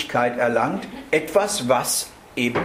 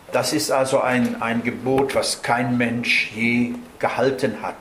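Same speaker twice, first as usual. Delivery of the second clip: normal talking voice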